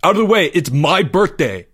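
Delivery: pushy, high-pitched yell. A narrator's reading. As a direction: yell your way through the crowd. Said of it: "Greg yelled".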